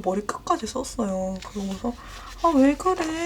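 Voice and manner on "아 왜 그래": higher, louder sleepy irritation; mock whiny complaint; annoyed. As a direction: grumble back at mom, annoyed. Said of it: "짜증내고".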